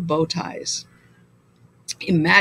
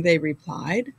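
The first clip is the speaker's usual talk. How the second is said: ordinary delivery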